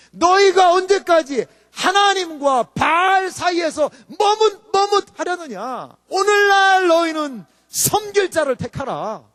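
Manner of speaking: slow, loud commanding proclamation; prophet's shout. Retold or imitated. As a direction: thunder the prophet's challenge. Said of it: "엘리야 선지자가 이스라엘 백성을 향하여서".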